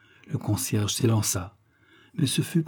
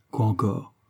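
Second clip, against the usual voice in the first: ordinary delivery, nothing marked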